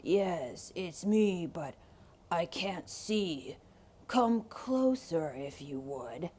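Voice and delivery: low sly wolf voice; disguised wolf. Acted. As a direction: fake grandpa with a wolf's voice.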